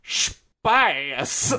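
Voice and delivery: hammy Russian villain; one word in a cheesy Russian accent; bad Russian accent. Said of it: "him doing a bad Russian accent".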